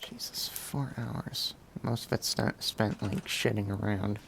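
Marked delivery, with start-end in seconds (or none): 0.0-1.5 slow, whispered disbelief; breathy disbelief. Real, not acted. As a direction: sigh it out in disbelief. Reacts to time played.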